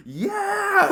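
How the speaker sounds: high, loud whooping yell; a loud cheer; loud American cheer. Retold or imitated. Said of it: "my dad was like".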